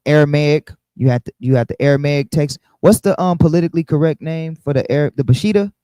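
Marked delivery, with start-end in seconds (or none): none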